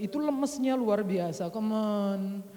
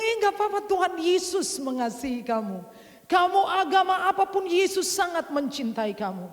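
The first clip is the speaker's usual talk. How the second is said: bright, higher voice that brushes the worry aside; eager reassurance; 8 semitones higher, 4 dB louder than usual. Retold or imitated.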